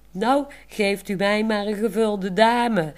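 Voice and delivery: a cheeky grin in the voice; grinning. Acted. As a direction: tease him with a sly grin. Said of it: "zegt Olaf grijnzend".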